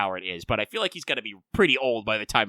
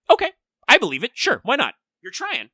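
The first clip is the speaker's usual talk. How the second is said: high, fast sarcastic agreement; 11 semitones higher, 7 dB louder than usual. Retold or imitated. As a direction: fake belief, dripping sarcasm. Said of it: "I'm like".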